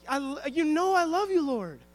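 a touch of anguished pleading; pleading Peter. Retold or imitated. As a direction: insist desperately that you love him. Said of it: "Peter's like".

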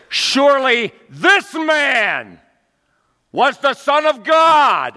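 high, loud, slow confession; soldier's declaration. Acted. Centurion at the cross, loud slow declaration. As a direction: proclaim it like a stunned soldier.

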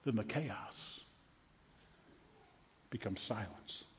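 very soft, low, long pauses; hushed stillness. Acted. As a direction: let it fall into silence. Chaos gives way to silence.

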